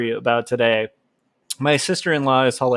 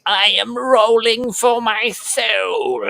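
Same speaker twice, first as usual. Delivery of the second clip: slow, much higher hammy demon; 9 semitones higher, slower than usual. Pretend. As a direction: play a cartoonish possessed soul, drawn out. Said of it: "he's gonna be like".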